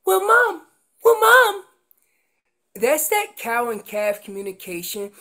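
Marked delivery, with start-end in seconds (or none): 0.1-1.7 put-on high, loud child calling over Mom; pestering kid. Kid interrupting a parent's talk, loud high pestering. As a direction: whine it up high and insistent, like a kid. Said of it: "And then they saying".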